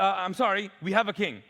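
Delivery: normal talking voice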